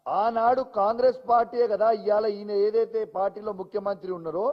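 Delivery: usual speaking voice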